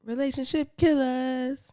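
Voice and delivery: lightly airy, high and stretched; dream voice. Shown as a demonstration. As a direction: float the title out like a dream. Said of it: "Hit it again".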